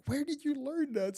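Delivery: high, amused disbelief; incredulous. Retold or imitated. Friends quizzing their confident companion, incredulous. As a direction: question him as if you can't believe it.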